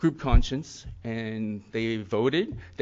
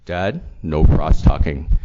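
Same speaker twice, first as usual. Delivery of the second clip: daughter's firm rebuke; 8 semitones lower, 11 dB louder, slower than usual. Retold or imitated. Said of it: "she said to me".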